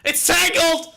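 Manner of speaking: high, loud cries of pain; real panicked shouting while the bat prop pulls his hair; pained yelps. Real, not acted. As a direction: yelp for help as your hair gets yanked.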